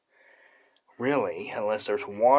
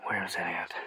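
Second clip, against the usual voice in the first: hushed whisper, searching; 5 semitones lower, 6 dB quieter than usual. Real, not acted. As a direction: whisper the question. Searching quietly.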